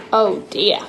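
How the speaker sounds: ordinary delivery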